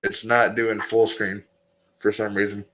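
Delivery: muffled behind a hand; hand over mouth. Real, not acted. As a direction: speak through your hand, muffled. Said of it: "with your hand over your mouth, you're muffled".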